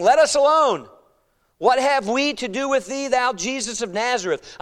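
high, loud cry of protest; demon crying out. Acted. Preacher voices the demon confronting Jesus, crying out. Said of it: "he cried out".

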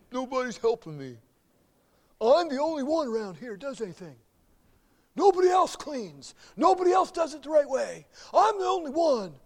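high, loud, self-pitying complaining; pity party whine. Retold or imitated. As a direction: wallow and whine that nobody helps. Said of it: "a little pity party".

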